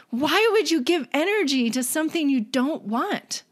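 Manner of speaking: put-on a small child who truly can't understand; puzzled child.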